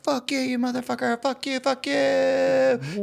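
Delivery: high, slow, mock children's yell; childish screaming. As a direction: scream it like a little kid. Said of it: "like little children screaming at this man".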